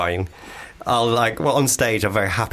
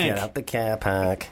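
slow, softer Manchester take; Manchester accent; 5 dB quieter, slower than usual. Retold or imitated. [name] replays his slip into Manchester, slow and soft. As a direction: draw it out quietly in Manchester. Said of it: "That sounded like Manchester at the end".

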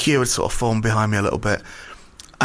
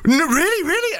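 much higher, breathless disbelief; amazed fan. Retold or imitated. The garage attendant realises he's met a comedian, high amazed gasp. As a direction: gush like a starstruck fan.

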